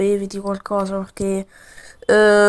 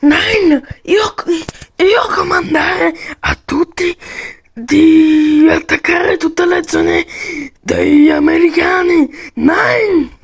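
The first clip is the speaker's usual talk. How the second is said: loud, higher broken-Italian commander barking orders; a toy general shouting to attack, slower on the orders; German commander; 5 semitones higher, 6 dB louder, slower than usual. Pretend.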